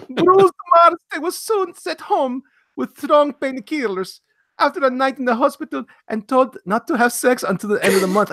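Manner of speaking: high, fast cartoon plumber shouting the news; hammed-up video game hero voice; Luigi voice. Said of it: "Now I'm gonna read this as Luigi".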